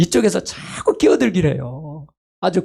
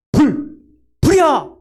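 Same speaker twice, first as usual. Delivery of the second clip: shouting fire; 10 semitones higher, slower than usual. Shown as a demonstration. Someone warning of a fire, shouting in alarm. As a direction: yell the alarm urgently. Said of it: "이렇게 소리 지르잖아요".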